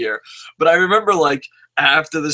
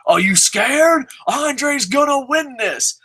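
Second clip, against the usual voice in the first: cocky taunt; 10 semitones higher, slower than usual. Retold or imitated. Kid taunting uncle over bet.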